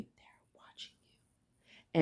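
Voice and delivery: hushed, breathy whisper; whispered warning. Shown as a demonstration. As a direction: drop to a secret whisper.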